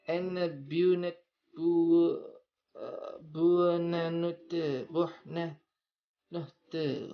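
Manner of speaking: slow, high buonanotte; drawn-out Italian goodnight; Italian accent. Shown as a demonstration. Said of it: "sudah bisa logat Italia saya".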